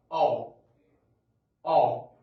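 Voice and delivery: آه said loud with rounded lips. Shown as a demonstration. Teacher showing the rounded o to students. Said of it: "بقول".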